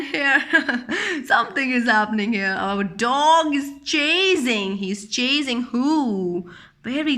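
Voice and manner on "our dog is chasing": ordinary delivery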